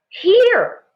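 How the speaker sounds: high, loud call; excited cry. A narrator's reading. Mother spots footprints, high loud cry. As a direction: shout it out as you spot something. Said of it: "Ma cried".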